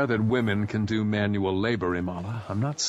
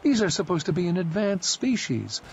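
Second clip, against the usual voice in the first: a touch higher, a disdainful woman; lightly voiced Imala; 6 semitones higher than usual. A narrator's reading. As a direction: lift the voice a little for Imala, unimpressed.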